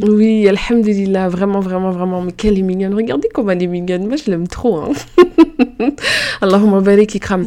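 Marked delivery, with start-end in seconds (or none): 4.9-6.4 high burst of delighted laughter; giggly laugh. Real, not acted. Host laughing with delight about her guest. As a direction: let out a high, gleeful squeal of a laugh.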